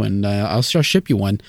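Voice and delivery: ordinary talk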